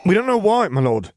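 nervous officer voice. Acted. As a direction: hurry to explain, afraid. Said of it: "he quickly explained".

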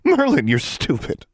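high, scornful jab; sneering insult. A narrator's reading. Darla taunting Alexandra, high sneering jab. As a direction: spit out the insult with contempt. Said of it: "Darla sneered".

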